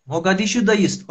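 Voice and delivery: usual speaking voice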